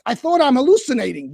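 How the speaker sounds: ordinary talk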